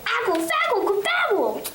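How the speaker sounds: put-on high, urgent baby babble; babbling toddler. Trixie babbles urgently at her daddy, high baby voice. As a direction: squeal nonsense words like a toddler.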